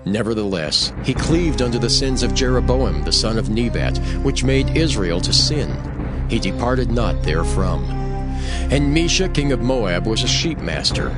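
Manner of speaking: usual speaking voice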